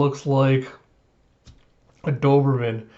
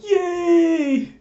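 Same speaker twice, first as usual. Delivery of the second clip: put-on childish cheer. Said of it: "Anya just be like".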